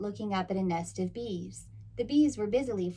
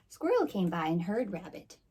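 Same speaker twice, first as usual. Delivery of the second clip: ordinary delivery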